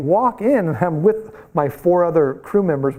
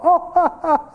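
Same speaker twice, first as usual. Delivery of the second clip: high, forced polite laughter; hammed-up fake chuckle; mock delayed laugh; 12 semitones higher than usual. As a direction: imitate someone laughing late without getting the joke. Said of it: "they're all".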